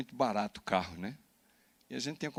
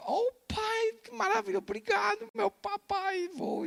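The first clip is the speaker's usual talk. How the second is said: put-on much higher, delighted little-girl voice; overjoyed daughter; slower than usual. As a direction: squeal with joy like a thrilled daughter. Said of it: "pagar uma passagem pra você vir". (filha eufórica, voz bem aguda e infantil)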